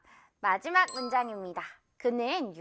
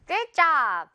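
ordinary talk, nothing marked

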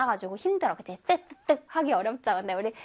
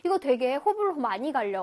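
ordinary delivery

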